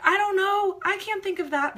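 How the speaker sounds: put-on high, loud, flustered child's voice; a kid too panicked to think; panicky kid. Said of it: "And they're like".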